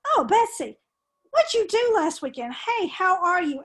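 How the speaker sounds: chatty, lively hello with pitch lifted; informal greeting. Shown as a demonstration. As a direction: greet a pal and invite a chat. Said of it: "goes back to the formal and informal".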